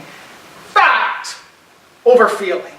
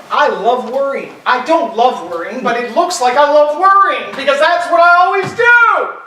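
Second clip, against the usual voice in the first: self-mocking rant; 6 semitones higher, 6 dB louder than usual. Real, not acted. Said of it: "my natural emotional makeup".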